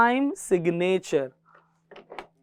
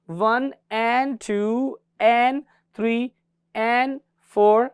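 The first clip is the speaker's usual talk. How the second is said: very slow count "one and टू and", stretching each "and"; teacher counting the and-beats slowly; much slower than usual. Shown as a demonstration. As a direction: draw out the count so the gaps are heard. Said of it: "उन gaps को क्या बोला जाता है".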